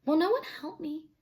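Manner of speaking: high, soft plea; pleading. Acted. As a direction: beg the crowd for help. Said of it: "she pleaded".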